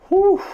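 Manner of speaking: high loud exhale of relief; relieved whew. Real, not acted. Gamer exhales in relief after the overtime win, high whew. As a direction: blow out the tension after the win.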